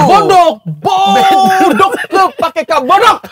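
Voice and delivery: mocking repeat. Real, not acted. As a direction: ridicule the word bodok. Mocking a mispronounced word.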